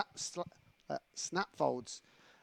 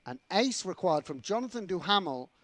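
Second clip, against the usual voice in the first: raised, punchy play-by-play delivery; sports commentator, pitched up; commentary voice; 4 semitones higher, 4 dB louder than usual. Real, not acted. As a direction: announce the stakes before the big card. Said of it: "That was my commentary voice".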